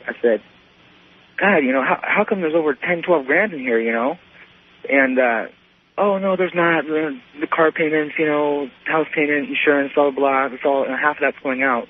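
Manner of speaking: ordinary talk, nothing marked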